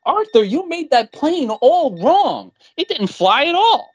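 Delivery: put-on high, slow, whiny kid sister voice; a sister blaming her brother in a shrill whine; bratty little sister. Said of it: "goes up to Arthur and says".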